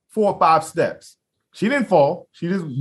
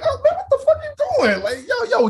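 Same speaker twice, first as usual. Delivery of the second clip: high, laughing, half joking; laughing disbelief. Retold or imitated. Laughing off his attacker's first swing, high disbelief. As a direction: laugh it off like a play punch.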